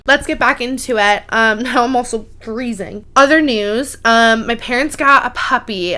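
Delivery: ordinary talk, nothing marked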